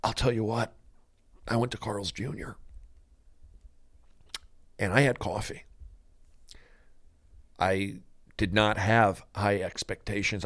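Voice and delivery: soft, halting, mock-solemn confession; quiet melodramatic monologue; acted emotional scene. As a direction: play it hushed, with long dramatic pauses. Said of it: "you're doing an emotional scene".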